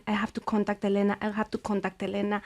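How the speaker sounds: hushed, softly repeated self-reminder; inner thought. Retold or imitated. Wife reminding herself privately, hushed inner voice. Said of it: "in the mind".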